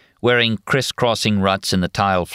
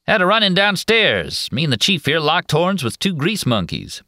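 cowboy drawl; 10 semitones higher than usual. Acted. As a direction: boast it like a swaggering cowboy. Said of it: "in his drawling cowboy actor's voice".

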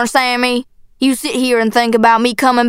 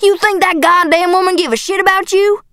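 high, incredulous angry outburst; angry scorn; 8 semitones higher than usual. A narrator's reading. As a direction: pour scorn on the idea. Father rages at the girl.

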